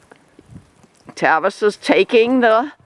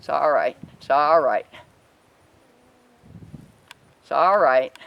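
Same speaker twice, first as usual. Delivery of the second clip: low, gentle reassurance; gentle voice for a spooked calf; soothing; 5 semitones lower than usual. Real, not acted. Soothing a nervous animal.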